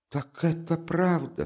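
high, slow exclamation; shocked cry. Acted. Mother learning the rumour is true, shocked cry. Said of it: "вскричала Пульхерия Александровна".

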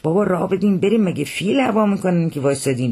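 exasperated coachman's call; calling to crowd. Acted. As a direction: call out for people to clear the way.

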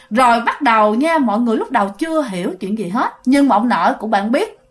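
plain reading voice

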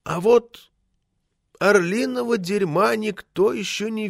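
high, slow, musing voice; folksy village writer. A narrator's reading. Village writer telling committee an eagle parable, high and musing. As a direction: draw the parable out slowly, high.

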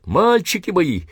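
very high, squealing woman's cry; shrill woman's voice. Acted. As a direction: squeal like a frantic old woman. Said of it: "визгливый бабий голос".

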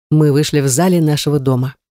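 ordinary delivery

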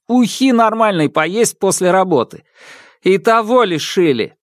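high, aggrieved rant; ranting complaint. Acted. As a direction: pour out the grievance. Brother rants about robot.

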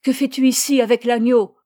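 loud, insistent questioning; father's forceful interrogation; stern demand. A narrator's reading. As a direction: demand an answer from a silent child. Father repeats his demand to son.

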